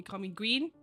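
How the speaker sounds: ordinary talk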